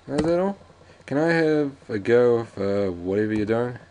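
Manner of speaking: put-on high, loud kid asking Dad; eager son. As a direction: pitch it up like a pestering boy. Son begging dad in game dialogue.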